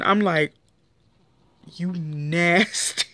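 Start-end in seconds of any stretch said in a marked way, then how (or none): 1.8-3.1 slow, drawn-out mock disgust; playful slow "you nasty"; disgusted tease. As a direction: drag it out like you're grossed out. Quoting her reaction to Duchess.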